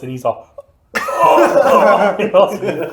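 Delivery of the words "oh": loud, high exaggerated "oh"; mock "oh" of realisation. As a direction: wail it as a humiliated friend would. Imitates friend realising his mistake.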